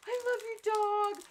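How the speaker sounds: put-on high, loud little-girl gush. Little girl at the door gushing over the costumed pet, high and loud. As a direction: gush like a small child at Halloween. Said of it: "she's like".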